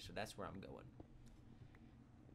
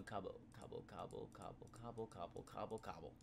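soft clucking turkey call; rooster imitation; 6 dB quieter than usual. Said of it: "Sounds like a rooster or a turkey".

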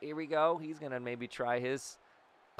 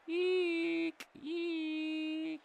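high squeak; 3 dB quieter than usual. Real, not acted. Squeal at a tense moment.

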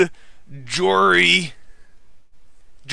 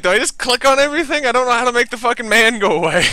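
flustered; 8 semitones higher, 6 dB louder, faster than usual. Real, not acted. Panicked streamer at creepy man.

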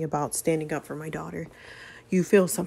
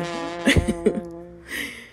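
laugh. Acted. Laugh after his mother's retort.

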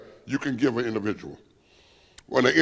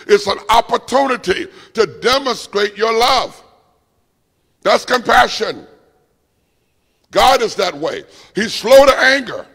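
raised, driving preacher voice; preaching at full force; 5 semitones higher, 4 dB louder than usual. Acted. Preacher rousing the congregation about grace, full-force preaching. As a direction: lift the room and press every line.

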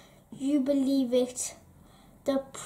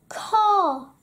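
loud, high crow call; croaking crow; 5 semitones higher, 6 dB louder than usual. A narrator's reading. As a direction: caw out like a crow. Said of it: "he croaked".

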